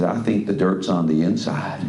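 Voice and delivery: slow, lightly put-on Southern American drawl; a friend's father from Arkansas, in a slow Southern accent. An Arkansas father in the car, in a gentle slow Southern accent. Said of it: "remember the southern accent".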